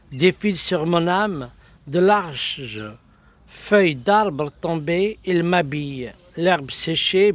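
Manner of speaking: spoken verse in lifted, measured phrases; poetry recital. Acted. Recites a poem.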